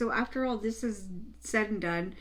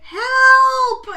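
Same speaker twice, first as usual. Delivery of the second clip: very high, loud, drawn-out mock cry; imitated scream for help; 14 dB louder than usual. Showing what the whole street could hear, mock scream. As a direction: wail it like the screaming neighbour. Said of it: "and then you hear her say".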